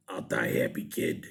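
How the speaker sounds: put-on very low, growly old-timer; old man impression. Recalling the old husband looking up at the piercer, low growl. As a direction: croak it like a decrepit old husband. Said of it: "that dude looking up at me being like".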